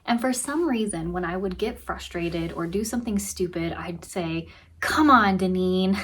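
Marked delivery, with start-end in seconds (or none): 4.8-6.0 sharp, loud scold to herself; harsh self-scolding. Retold or imitated. Scolding herself after a mistake, harsh and loud. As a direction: snap your own name in frustration. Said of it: "And that was really harsh".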